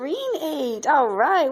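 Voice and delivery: high, bright sing-song for a little one. Real, not acted. Parent naming magnets with toddler.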